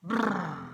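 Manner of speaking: loud rolled brrr, high then falling; imitated engine roar. A narrator's reading. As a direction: rev like a speeding ambulance. Imitates engine under hard acceleration.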